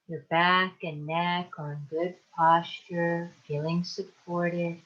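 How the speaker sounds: calm, unhurried meditation guide with long pauses; guided meditation. Acted. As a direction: ease them into relaxation, leaving space to breathe. Leads a guided relaxation meditation.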